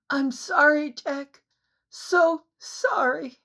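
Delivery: high, slow sobbing apology. A narrator's reading. Anne sobbing her apology to Jack, high and slow. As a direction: sob it out, voice breaking. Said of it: "with a mighty sob, she cried".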